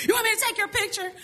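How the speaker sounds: high, fast mock voice of the little instructor. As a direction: toss it off quick and high, teasing the instructor. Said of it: "He's like".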